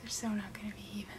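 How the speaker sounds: hushed whispered aside; whispering. Real, not acted. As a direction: whisper it to yourself. Quiet aside while applying makeup.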